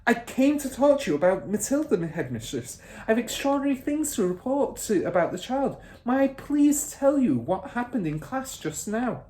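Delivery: put-on teacher begging to report on the child; eager plea. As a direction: plead earnestly. Miss Honey voiced.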